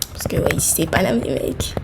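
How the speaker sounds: hushed aside to the guys; whisper. Acted. Telling viewers where she lives, whispered. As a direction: drop to a breathy secret.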